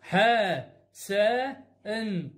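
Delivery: ح، س، ان as three separate sounds, "haa, saa, enn"; slow phonics demonstration of ح، س، ان; sounding out letters. Shown as a demonstration. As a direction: spell out ح، س، ان with a pause after each. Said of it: "صارت الكلمه ح".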